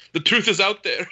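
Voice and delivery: high, loud mock-nervous outburst; nervous blurt. Retelling an awkward movie line at the kitchen table, nervous blurt. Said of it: "kind of nervously blurts out".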